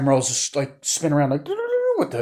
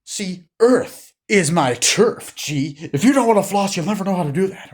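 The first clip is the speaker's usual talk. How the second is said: Robotnik taunt; 8 semitones higher, 6 dB louder than usual. Retold or imitated. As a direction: drag it out like a smug mad scientist. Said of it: "He's like".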